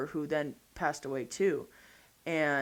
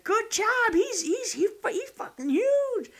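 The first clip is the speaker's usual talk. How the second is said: high, thrilled voice; mock excited old man; excited praise; 4 dB louder, slower than usual. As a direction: gush over the huge buck. Father-in-law praising big buck.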